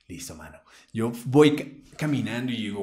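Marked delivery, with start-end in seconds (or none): none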